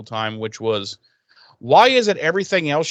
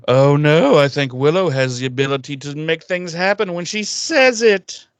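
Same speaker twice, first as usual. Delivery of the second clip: loud, hammed-up alarm; exaggerated exclamation, building louder to the end; mock realisation; 3 semitones higher, 3 dB louder than usual. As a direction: announce the obvious with fake dismay. Mock dawning realisation, acted loudly.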